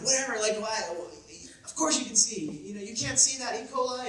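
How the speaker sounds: mock mom scoffing at her son; imitating mom. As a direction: brush it off as an unbelieving mom. Mom voiced dismissing son.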